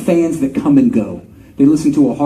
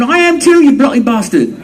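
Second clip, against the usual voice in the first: much higher, louder, hammed-up British outrage with laughs; mock indignant Brit; 9 semitones higher, 5 dB louder than usual. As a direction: play the offended celebrity, laughing. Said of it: "I'm bullshitting a little bit".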